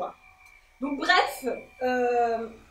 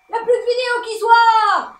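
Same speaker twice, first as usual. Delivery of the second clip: much higher, louder cry of the line; loud reality-show wail; 9 semitones higher, 10 dB louder than usual. Retold or imitated. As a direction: push it up into a big dramatic wail. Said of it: "Piètre imitation de Sarah de Koh-Lanta".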